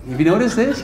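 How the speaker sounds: ordinary delivery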